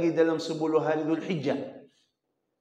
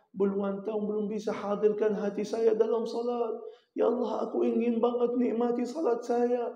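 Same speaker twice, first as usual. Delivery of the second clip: pleading prayer; 10 semitones higher than usual. Acted. Preacher voicing a worshipper praying, high and pleading. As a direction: beg God as a worshipper ashamed of himself.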